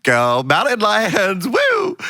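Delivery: high, whooping cheerleader shout; hammed-up American pep-rally yell; excited cheer. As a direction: cheer the school team, stretched out and high. Acted American cheerleader school cheer.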